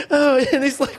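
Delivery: usual speaking voice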